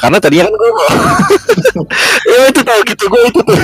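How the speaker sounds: laughing through the words; high, breaking into laughter. Real, not acted. Laughs at own failed buy.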